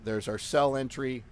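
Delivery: ordinary delivery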